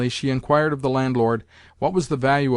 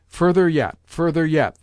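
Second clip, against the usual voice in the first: higher, louder insistent call; cried; 4 semitones higher, 5 dB louder than usual. Acted. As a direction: urge the lackeys on, commanding. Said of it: "the old lady cried".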